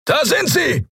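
very high-pitched cry of alarm; piercing yell. A narrator's reading. A voice down the street spots the fugitives, piercing yell. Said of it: "gellte eine Stimme".

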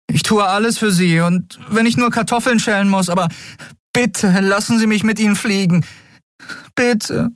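put-on high boy's voice begging, near tears; pleading boy. Miko begs the captain.

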